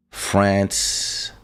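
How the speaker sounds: normal talking voice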